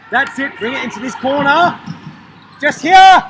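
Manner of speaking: high, building to a loud call; urging on. Real, not acted. Urging the team into the corner, building shout. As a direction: egg the player on, rising to a shout.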